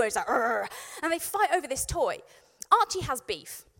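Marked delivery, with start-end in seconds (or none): 0.3-0.7 imitated aggressive growl; angry dog growl. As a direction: growl like a dog fighting over a toy. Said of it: "he gets really angry and aggressive".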